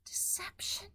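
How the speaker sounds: breathy whispered disbelief. Real, not acted. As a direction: whisper the word in doubt. Whispered echo.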